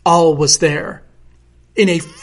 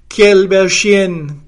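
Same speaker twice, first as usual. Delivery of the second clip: slow, louder French exclamation; lightly acted French crowd; admiring cry; 2 semitones higher, 5 dB louder, much slower than usual. A narrator's reading. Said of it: "cried the French people".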